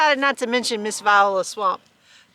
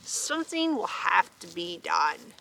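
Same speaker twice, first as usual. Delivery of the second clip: scheming teacher; 6 semitones lower, 8 dB quieter than usual. A narrator's reading. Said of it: "said Miss Nelson".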